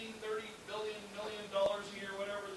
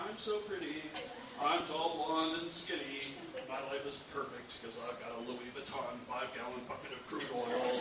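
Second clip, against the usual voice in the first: airhead ad model; 8 semitones lower than usual. Pretend. The pretty gal in the TV ad, lightly voiced vain model. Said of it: "this pretty gal walking down Rodeo".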